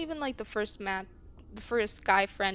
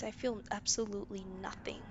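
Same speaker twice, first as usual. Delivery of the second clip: whispered, much softer; whispering that she feels nothing; numb whisper; 8 dB quieter than usual. Real, not acted. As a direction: let it fall to a numb whisper. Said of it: "I don't feel anything".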